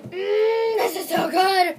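loud, high gush of delight. Real, not acted. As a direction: gush over the smell.

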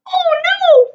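very high, loud frightened squeal; little pig squealing in fright. A narrator's reading. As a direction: shriek like a scared piglet. Pig cries out.

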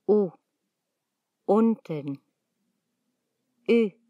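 ordinary delivery

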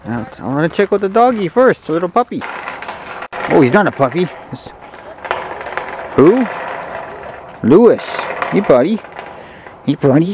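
high, bright dog-greeting voice; pet voice. Real, not acted. Greeting the little dog Lewis in a high pet voice. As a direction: coo up high at the puppy.

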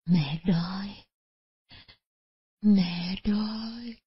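put-on weak, drawn-out old mother's whimper; feeble faint moan. Starving mother to her daughters at her ear, feebly. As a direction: barely get it out, drained of strength. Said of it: "mới nghe bà thều thào".

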